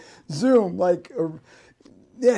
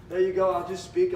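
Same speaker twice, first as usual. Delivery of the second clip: normal talking voice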